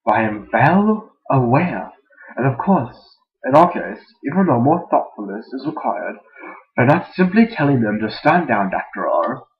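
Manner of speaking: put-on loud, wandering British and German accent; hammed-up German sliding into British. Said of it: "The bipolar British and German guy".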